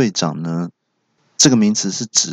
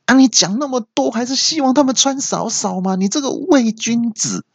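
very high teasing accusation; mocking friend. Friend calling narrator a hypocrite, high teasing. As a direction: call him out like a smug friend.

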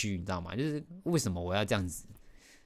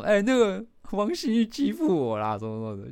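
aggrieved complaint; 10 semitones higher, 3 dB louder than usual. Retold or imitated. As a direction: complain like a wronged little kid. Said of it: "表达我的委屈跟她讲". (委屈地告状，声音高而慢)

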